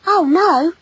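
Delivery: put-on high, young protest; flustered boy. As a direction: deny it in a small high voice. Boy hastily reassures her.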